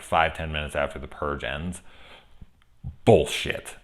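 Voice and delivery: ordinary talk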